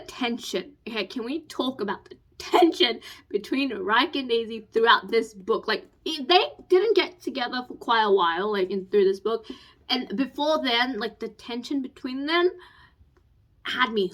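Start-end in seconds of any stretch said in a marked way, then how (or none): none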